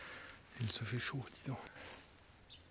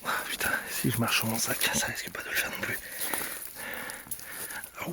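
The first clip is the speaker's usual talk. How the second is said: muttered under the breath; whispering. Real, not acted. Stumbling through mud, muttering. (chuchoté pour soi)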